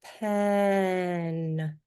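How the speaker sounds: the word "Pen" stretched out slowly; teacher demonstrates the word slowly to a student. Shown as a demonstration. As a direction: model "Pen" slowly for a child, sound by sound. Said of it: "I'm gonna say it slowly".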